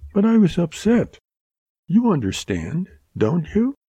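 high, lightly voiced girl; young woman Mary, apologetic. A narrator's reading. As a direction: soften into an apology.

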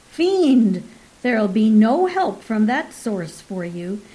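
loud, triumphant crowing at the trapped fiend; gleeful shout. Acted. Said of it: "Thomas Bretnor laughed aloud".

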